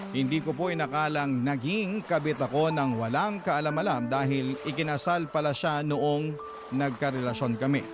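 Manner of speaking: normal talking voice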